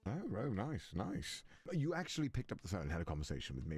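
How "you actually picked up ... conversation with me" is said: low, polished butler voice; courteous, smooth demon butler; butler character voice. Acted. Plays Sebastian on the phone.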